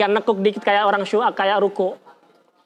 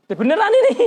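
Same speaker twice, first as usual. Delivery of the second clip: sudden high exclaim; 11 semitones higher, faster than usual. Real, not acted. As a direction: burst out in disbelief. Exclaiming at a wrongly laid body.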